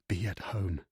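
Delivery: willing her to pick up, softly; whispered plea. A narrator's reading. Gaddis begs the ringing phone, softly. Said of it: "he whispered".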